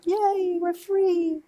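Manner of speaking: high giddy cheer; mock gleeful.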